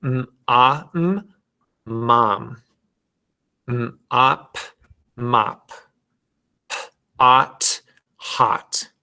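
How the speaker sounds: letter sounds alone with pauses, then blended, as in "m, ah, m, mom"; segment, pause, then blend each word for the class; sounding out. Shown as a demonstration. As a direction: phonics drill on open "ah" words. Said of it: "We're gonna do the same thing".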